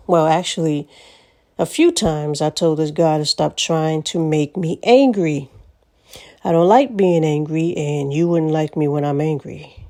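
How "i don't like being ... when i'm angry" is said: normal talking voice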